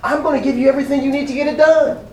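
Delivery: rising, fervent preacher's call; full-force preaching. Acted. Preacher voicing God to the church, fervent and rising. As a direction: preach it out to the congregation with growing fire.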